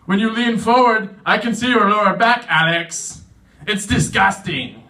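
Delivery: acted-out disgusted telling-off; complaining boss. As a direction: nag with the name tacked on like a reprimand. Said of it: "My boss at work is always complaining".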